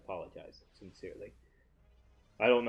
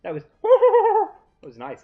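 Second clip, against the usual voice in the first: laughing; 9 semitones higher, 11 dB louder than usual. Real, not acted. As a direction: cackle with glee at the attack. Delighted by game animation.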